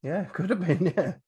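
usual speaking voice

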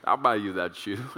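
low, smooth husband voice; sweet-talking husband. Acted. Husband promising his wife that shoe, sweet-talking. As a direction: croon it low to your wife. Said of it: "That's how I talk to my wife".

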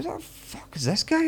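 a stunned inner what-the-hell; incredulous. Retold or imitated. Stranger whispering on the balcony: high, slow incredulity.

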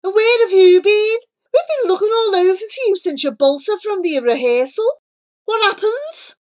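high-pitched, loud, hurried questioning; excitable boy character, pitched right up; indignant friend. Acted. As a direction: demand answers in a rush. Breathless friend demands explanation.

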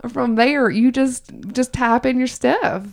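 walk y'all through it in a hammed-up Southern accent. Host explains the web form in a Southern accent. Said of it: "in my Southern accent".

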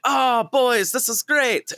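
high, cheery cartoon voice; high-pitched character voice; restaurant owner imitation. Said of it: "he's like".

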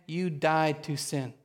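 "you died to sin" slowed, weight on "died"; slow emphasis. Shown as a demonstration. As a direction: drive home "died" slowly. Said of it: "You need to take, just take it in".

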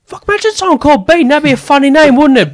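very high, loud put-on voice; ranting tangent mimicry. Star riffing on a misheard name, high loud mock rant. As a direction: imitate him running away with the joke, shrill and loud. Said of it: "he just goes on his own little tangent".

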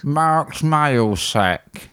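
very slow drawn-out announcer voice; slow mock announcer; segment announcement. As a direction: announce it like a show title.

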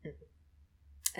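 breathy hushed mm-hmm; whispered mm-hmm. Retold or imitated. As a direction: answer in a low whisper. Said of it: "And he went".

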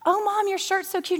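high-pitched mock gushing; admiring daughter. Daughter complimenting her mom in a retold teasing routine, high admiring voice. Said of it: "'cause we'd be like".

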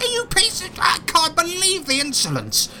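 very high, sputtering fury; outraged spider. Acted. Spider sputtering at the player's insults, very high outrage. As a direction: choke on the insolence.

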